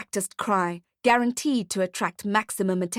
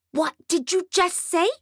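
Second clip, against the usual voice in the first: put-on higher, indignant child; affronted little brat; bratty girl voice; 9 semitones higher than usual. A narrator's reading.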